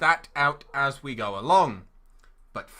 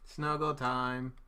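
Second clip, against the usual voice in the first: soft slow cooing; tender; 4 semitones lower, 10 dB quieter, slower than usual. Real, not acted. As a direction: coo it gently to a pet. Cooing at pet rabbits.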